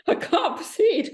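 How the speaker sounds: high, slow words through laughter; overcome with laughter. Real, not acted. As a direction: laugh so much you can barely go on. Breaks up over the reparations joke.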